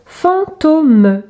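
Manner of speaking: put-on segmented word for young readers; syllable by syllable. Shown as a demonstration. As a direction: split "fantôme" into two long syllables.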